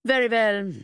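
words stretched by a yawn. A narrator's reading. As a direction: say it through a yawn, high and loud. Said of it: "He yawned behind the side of his fist".

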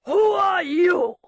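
loud, slow, high shout; demanding shout. A narrator's reading. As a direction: shout it at the intruder. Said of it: "The Baron demanded".

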